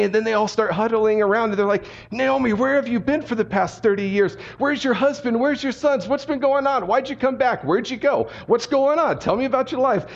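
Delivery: small-town women crowding round, excited and nosy; gossiping village women. Retold or imitated. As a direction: pepper her with questions like nosy neighbours. Village women mobbing Naomi with questions.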